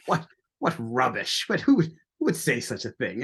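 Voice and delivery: hammed-up British officer accent, pitch lifting at the end; terrible British accent. British veteran recalling the brash American lieutenant, terrible British accent. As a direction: badly fake a British gentleman's accent. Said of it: "my terrible British accent here".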